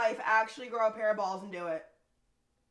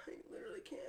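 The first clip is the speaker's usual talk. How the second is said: breathless whisper of defeat; exasperated whisper; 10 semitones higher, 18 dB quieter, much faster than usual. Real, not acted. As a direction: whisper it, utterly done. Exasperated with friend.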